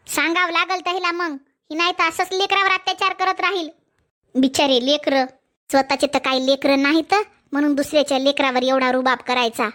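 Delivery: an elder condemning the stepmother; disapproving elder woman. Acted.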